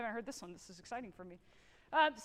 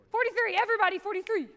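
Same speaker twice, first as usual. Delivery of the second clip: raised, high call across the room; 7 semitones higher, 8 dB louder, faster than usual. Real, not acted. Conductor calls the measure to the whole band, loud and high. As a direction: call the bar number to everyone.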